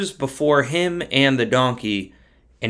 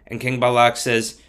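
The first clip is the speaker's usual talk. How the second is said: ordinary delivery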